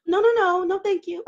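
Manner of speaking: high, prim no-thank-you; polite refusal. Retold or imitated. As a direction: decline him sweetly but firmly. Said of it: "My reaction is like".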